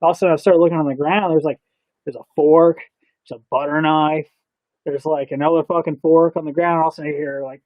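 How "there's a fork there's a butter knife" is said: normal talking voice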